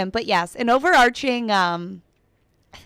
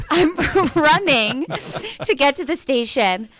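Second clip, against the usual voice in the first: laughing while talking; 5 semitones higher than usual. Real, not acted. Host telling colleagues about running to the station, laughing. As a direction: laugh through the words.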